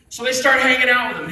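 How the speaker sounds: normal talking voice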